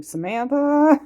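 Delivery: anxious parent calling out; nervous warning call. Retold or imitated. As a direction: call her name in alarm from the passenger seat. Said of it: "I was like".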